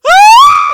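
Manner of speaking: very high, loud, long scream; fan scream. Pretend. Turning around after meeting an idol, screaming. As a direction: let out a piercing excited scream.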